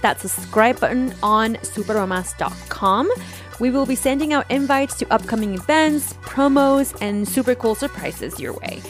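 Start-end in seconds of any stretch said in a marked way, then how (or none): none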